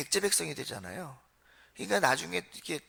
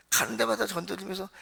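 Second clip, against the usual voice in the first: ordinary talk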